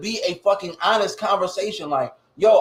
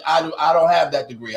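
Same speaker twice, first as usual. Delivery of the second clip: ordinary talk, nothing marked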